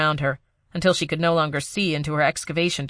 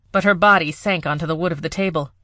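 ordinary delivery, nothing marked

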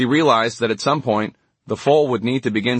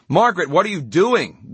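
higher-pitched, restrained narrated shout; mild shout; 6 semitones higher than usual. A narrator's reading. Gwinnett calls after Margaret running down the beach, mild shout. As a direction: call out in alarm without full volume. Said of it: "Gwinnett shouted from behind her".

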